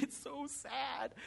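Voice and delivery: high, soft, drawn-out mock sob; thin, high retold crying, words stretched out; imitated weeping. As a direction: act out wailing through tears, slow and quiet.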